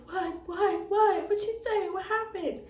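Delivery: high, loud, rapid alarmed what what; alarmed questions. Retold or imitated. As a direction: imitate the startled social worker, voice jumping up. Social worker alarmed on phone.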